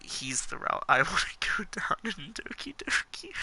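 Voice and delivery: whispered through giggles; giggling whisper. Real, not acted. Joking about dating the cat, giggling whisper. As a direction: giggle it under your breath.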